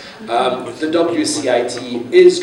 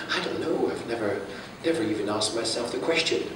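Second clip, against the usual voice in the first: quoted admission, much softer; imitating baffled reply; 11 dB quieter than usual. Speaker voicing what people back home all say, baffled. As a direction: drop the volume to voice others' puzzlement.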